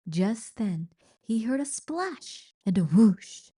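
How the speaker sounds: plain reading voice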